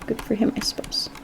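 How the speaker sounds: whispered aside. Acted. Muttered aside.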